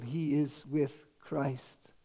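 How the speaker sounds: a quiet, slowed assurance; hushed and slow. Real, not acted. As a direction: drop soft and very slow. Solemn point after a pause.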